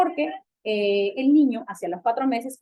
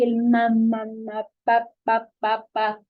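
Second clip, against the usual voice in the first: put-on repeated ma and pa syllables like a baby; canonical babbling; 3 dB louder, much slower than usual. Shown as a demonstration. Doctor demonstrating canonical babbling to students, babyish syllables. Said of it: "balbuceo que se llama canónico".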